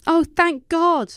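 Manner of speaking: high, slow, breathed out; breathy relief. A narrator's reading. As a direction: exhale the relief. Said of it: "Mom breathed".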